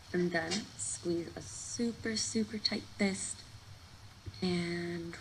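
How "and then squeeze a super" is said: ordinary talk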